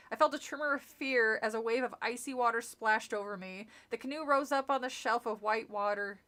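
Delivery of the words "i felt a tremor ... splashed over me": plain reading voice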